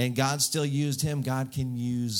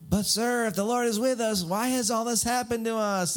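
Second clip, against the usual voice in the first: hammed-up whining complaint; whiny; 10 semitones higher than usual. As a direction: whine in protest like a wronged kid. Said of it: "he kinda gets all whiny".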